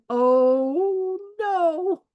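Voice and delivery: a dismayed oh no, rising high on the no; a daughter realising she's been caught. Retold or imitated. Daughter's tattoo spotted by her mother, mock dismay. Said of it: "you could see she went".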